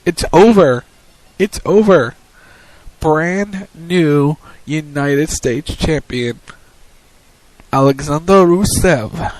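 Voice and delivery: loud call, then drawn-out title announcement; big ringside winner announcement; dramatic match call. Real, not acted. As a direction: announce the new champion slowly and emphatically. Calls the title win live.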